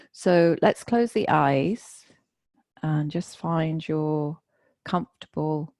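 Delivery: slow meditation-leader delivery with long pauses; guided meditation. Real, not acted. Teacher settling the group with eyes closed, slow guided meditation. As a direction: ease them into stillness, unhurriedly.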